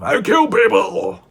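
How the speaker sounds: high, slow mock yell; retold scream. Quoting the attacker's threat, a high slow mock yell. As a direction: belt it like a raging threat. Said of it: "he screamed stuff".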